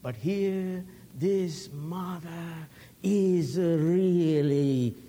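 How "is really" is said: really slow and louder; dragged out. Real, not acted. Preacher to congregation, drawn-out emphasis. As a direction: lean hard on each syllable. Preacher stretches and presses the words.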